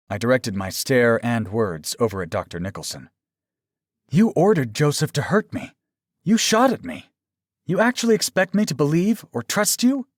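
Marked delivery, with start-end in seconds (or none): 4.1-10.1 high, louder, outraged accusation; indignant accusation. A narrator's reading. Captive confronting the doctor who hurt her, indignant. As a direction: confront him in disbelief, pitch climbing.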